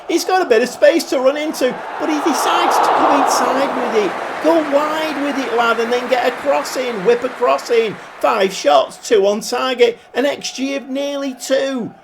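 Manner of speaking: high-pitched, urgent play-by-play; excited commentary. Real, not acted. Live commentary on a promising attack.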